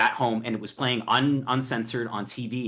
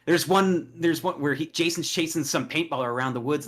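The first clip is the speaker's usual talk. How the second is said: ordinary talk, nothing marked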